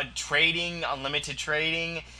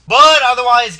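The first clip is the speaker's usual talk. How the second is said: loud, higher push to change topic; loud wrap-up; 7 semitones higher, 14 dB louder than usual. Acted. Vlogger wrapping up for viewers, loud turn. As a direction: bark it to close the subject.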